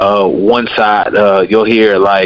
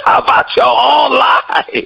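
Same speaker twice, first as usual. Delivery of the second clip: mock shout; 14 semitones higher, much slower than usual. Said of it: "mock retort quoted".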